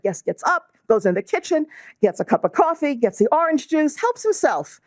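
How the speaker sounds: put-on light New York accent, rising in pitch; a hint of New York in the rabbi's words. Speaker retelling the rabbi's words with a mild New York accent. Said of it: "that was my New York accent coming out".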